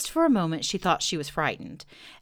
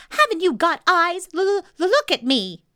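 high, loud, stuttering little voice; exasperated shout; 12 semitones higher, 8 dB louder than usual. A narrator's reading. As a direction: demand attention in a squeaky yell. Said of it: "shouted Piglet".

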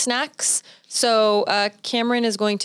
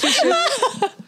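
high giggle; 14 semitones higher than usual. Real, not acted. Giggling fit while filming.

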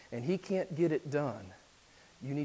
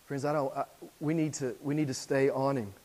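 normal talking voice

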